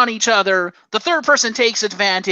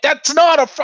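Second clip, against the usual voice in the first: higher, louder mock outrage; indignant protest; 8 semitones higher, 5 dB louder than usual. Retelling a reaction to a bad frog splash, mock-indignant. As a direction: protest in disbelief, cut short. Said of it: "I'm like".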